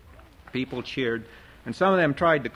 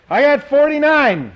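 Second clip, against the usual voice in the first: much higher, louder auctioneer call; raised voice; 14 semitones higher, 10 dB louder than usual. A narrator's reading. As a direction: raise the voice to sell it. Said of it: "Art raised his voice".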